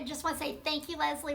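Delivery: normal talking voice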